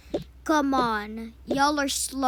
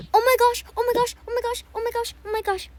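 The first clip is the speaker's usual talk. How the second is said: high, louder rapid oh my gosh; giddy higher chant of oh my gosh; overjoyed squealing; 10 semitones higher, 6 dB louder, much faster than usual. Acted. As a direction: squeal it over and over with glee. Child overjoyed about trip.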